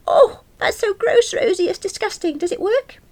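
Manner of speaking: very high, loud, appalled outburst; shrieky grossed-out reaction; disgusted squeal. Acted. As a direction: squeal in horror, then curiosity. Character squeals in disgust at remedy.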